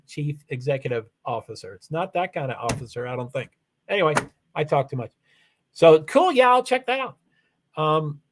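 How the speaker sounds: normal talking voice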